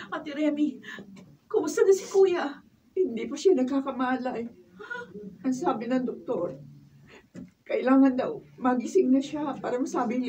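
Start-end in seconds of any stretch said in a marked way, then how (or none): none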